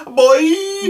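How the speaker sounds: put-on high, loud Caribbean accent exclamation. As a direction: shout it in a bad Caribbean accent. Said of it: "Caribbean accent's terrible".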